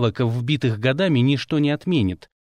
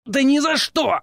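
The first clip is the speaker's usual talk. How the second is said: growled out; 12 semitones higher than usual. A narrator's reading. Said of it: "прорычал тот".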